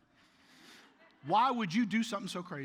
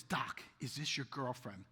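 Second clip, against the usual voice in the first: a lower, softer, slow sly question; mock patient prying at the doctor; sly insinuation; 7 semitones lower, 8 dB quieter, slower than usual. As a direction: tease him with it slowly. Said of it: "she looks and says".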